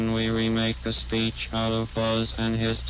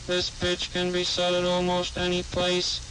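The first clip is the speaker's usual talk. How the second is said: raised vocoder monotone; higher vocoder pitch; 5 semitones higher than usual. Shown as a demonstration. Demonstrator explains the pitch dial, in higher vocoder monotone. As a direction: machine voice held on one higher note. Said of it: "for a different pitch, which is somewhat higher".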